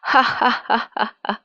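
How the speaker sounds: staccato acted villain cackle; mocking witch laugh. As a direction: laugh scornfully, syllable by syllable. Witch laughs at captive's defiance. (चुड़ैल की उपहास भरी हँसी)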